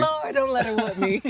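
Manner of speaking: lightly voiced scared kid praying; mock frightened prayer. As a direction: beg the Lord in a small panic. Kid praying against a whipping.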